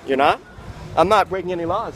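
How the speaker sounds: loud, defensive objection; indignant protest. Real, not acted. Homeless candidate argues loudly with guard over his rights. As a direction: push back hard on the guard.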